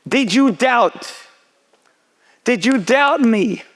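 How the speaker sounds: slow, high, drawn-out challenge; acted father's dramatic questioning; pressing question. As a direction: draw each word out to press the point. Father solemnly presses daughter.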